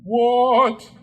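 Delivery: sharp high cry of outrage; the ghost flares up, loud and high; exclaimed. Acted. Offended ghost to Scrooge, loud high exclamation. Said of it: "exclaimed the ghost".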